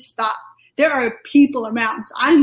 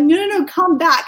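higher, louder retold plea; urgent call back; 5 semitones higher, 5 dB louder than usual. Calling the departing angel back, urgent plea. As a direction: call urgently after someone leaving.

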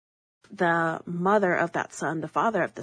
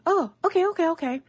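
much higher, quick string of agreement; high-voiced mimicry; 11 semitones higher, 5 dB louder, faster than usual. Grandma answering the dinner plans, high-voiced mimicry. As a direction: imitate her in a high, light voice.

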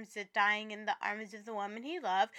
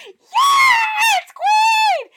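cheering shout; 17 dB louder than usual. Retold or imitated. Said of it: "And I'm like".

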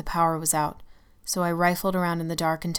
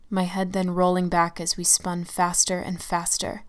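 ordinary delivery, nothing marked

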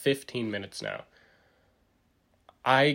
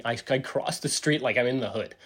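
ordinary talk, nothing marked

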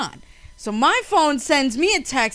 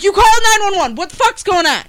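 louder, higher accusation; retold alarmed confrontation; 5 semitones higher, 7 dB louder, faster than usual. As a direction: confront them, alarmed, over the phone. Retold panicked phone confrontation.

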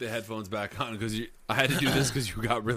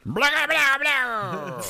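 silly high voice; 5 dB louder, much slower than usual. Pretend. Mocking the story in a silly voice.

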